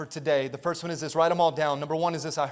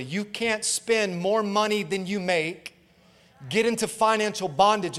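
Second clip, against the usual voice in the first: normal talking voice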